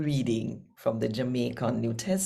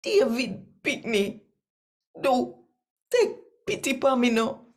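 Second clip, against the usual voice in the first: high, louder, more desperate plea; beggar's louder second shout for pity; 10 semitones higher, 4 dB louder than usual. A narrator's reading. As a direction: push the cry harder over the crowd. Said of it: "But him shout out louder".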